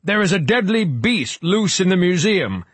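high, strained-pitch proclamation to a crowd; shouted warning. A narrator's reading. Frock shouts into the silence, high alarmed warning. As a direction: cry out the alarm to everyone. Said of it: "Frock shouted into the silence".

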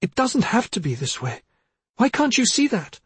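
high, rising protest; desperate pleading. A narrator's reading. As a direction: plead to be understood, pitch climbing. Daughter pleads as she is pushed.

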